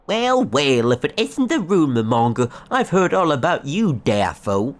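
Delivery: loud sneering ranger voice; sneering NPC. Acted. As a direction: greet them with a smug sneer.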